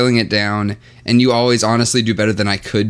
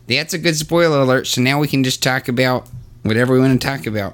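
Chicago local giving the spoiler alert; Chicago accent. Shown as a demonstration. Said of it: "Since you're doing the Chicago accent".

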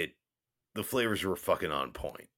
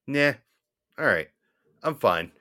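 ordinary talk, nothing marked

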